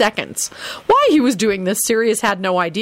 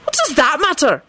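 high, indignant protest; incredulous pushback, pitched up; disbelieving; 9 semitones higher than usual. A narrator's reading. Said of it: "Benji said disbelievingly".

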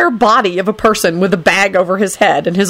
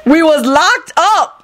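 high, drawn-out bawl; stretched-out wailing complaint; 9 semitones higher, slower than usual. A narrator's reading. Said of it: "Lucretia bawled out".

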